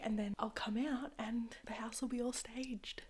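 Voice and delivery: hushed whisper. Real, not acted. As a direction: whisper so nobody hears. Stagers arriving, hiding in room.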